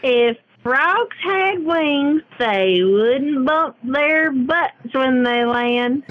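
slow drawn-out Southern twang; Southern drawl. Acted. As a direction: stretch it, lazy Southern drawl. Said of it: "That's how you need to talk".

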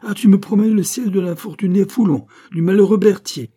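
high, loud exasperated outburst; acted fury at full voice; vociferating. A narrator's reading. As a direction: cry out in outraged disbelief. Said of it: "l'exaspération de l'abbé".

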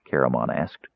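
ordinary delivery

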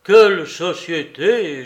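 loud, drawn-out groaning lament; moaning. A narrator's reading. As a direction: moan it out in slow exasperation. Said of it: "gémit Soupe".

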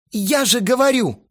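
high, loud protest; exasperated. A narrator's reading. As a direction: insist in exasperation. Exasperated Astarot, pacing nervously. (раздражённо, на повышенных тонах)

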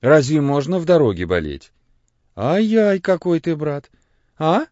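high, loud, tutting concern; fussing reproach. A narrator's reading. Father Christopher chiding the sick boy, fussing. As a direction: chide with fond alarm.

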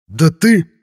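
loud, high indignant cry; angry outburst. Acted. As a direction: burst out in anger. Insolent youth starts to retort.

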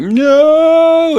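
high, stretched slow-motion cry of no; a hammed-up slow-motion yell; slow motion shout. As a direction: drag the no out like a film slowed down. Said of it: "like, slow motion".

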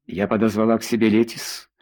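ordinary delivery, nothing marked

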